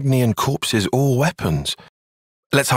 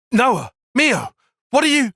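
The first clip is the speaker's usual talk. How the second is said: alarmed shout; 12 semitones higher than usual. Acted. Calling out in alarm to Noah and Mio. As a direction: yell their names in shock.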